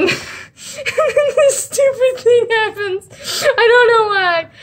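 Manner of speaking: high, laughing through the words; helpless real giggles while explaining; cracking up. Real, not acted. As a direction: talk through laughter, pitch shooting up. Tickled by the absurd picture.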